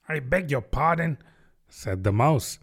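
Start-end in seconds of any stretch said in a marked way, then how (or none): 0.1-1.2 very polite, lightly voiced reproof; frowning politeness. A narrator's reading. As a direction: challenge it with careful courtesy. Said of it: "frowning, but very politely".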